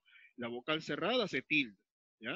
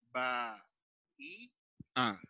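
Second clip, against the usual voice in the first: "ba, i, a" with a clear pause between each; teacher splitting the hiatus in "ba, i, a"; separated syllables; 4 semitones lower, much slower than usual. Shown as a demonstration. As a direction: slowly sound out "ba, i, a" one piece at a time. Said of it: "me dice que debo de separar".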